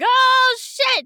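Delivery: very high, loud squawk of dismay; hammed-up horrified yelp; mock alarm. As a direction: shriek it in comic panic. Retelling character's horrified reaction.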